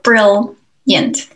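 'brilliant' split as brill-yent with a gap; two syllables. Shown as a demonstration. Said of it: "get out all at once".

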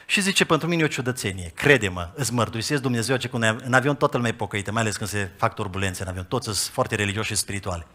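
quiet retold confidence. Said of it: "îmi zice în felul următor".

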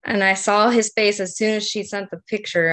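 ordinary talk

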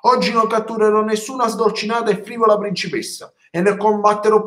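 a proud dragon storming off in a huff; indignant dragon. Acted. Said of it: "il drago indignato se n'è andato sbuffando".

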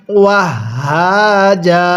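slow "ওয়াহ হা জা" ending in long aa; drawn-out stop on "jaa"; pausal form. Shown as a demonstration. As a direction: hold "জা" open with no n. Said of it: "এরকমভাবে শিখব".

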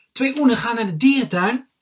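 put-on high, loud little-boy joke telling; little brother. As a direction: belt out a joke in a child's high voice.

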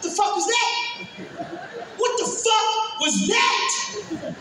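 drawn-out disbelief; stunned disbelief. Retold or imitated.

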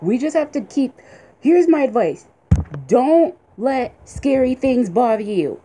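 ordinary talk

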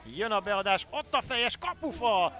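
excited hungarian sports commentary. Real, not acted. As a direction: call the chance with a rush. Near miss in commentary.

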